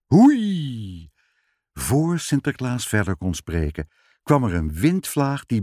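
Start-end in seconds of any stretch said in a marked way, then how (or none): 0.1-1.1 a louder, higher whoosh of storm wind; mock wind howl. A narrator's reading. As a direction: blow it out like a gust at sea. Storm wind rising at sea.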